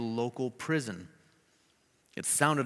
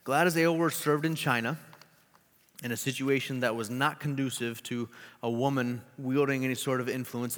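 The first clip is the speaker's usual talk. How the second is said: ordinary talk, nothing marked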